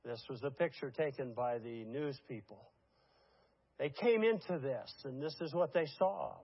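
ordinary talk

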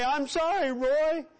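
showy Facebook apology, rising; mock public apology. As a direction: ham up a public-display apology. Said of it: "get on Facebook and say".

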